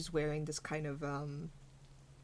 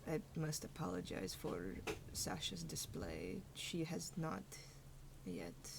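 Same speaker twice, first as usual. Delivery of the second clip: softer, measured voice of a composed firbolg woman; lightly voiced calm elder apologizing quietly; 7 dB quieter than usual.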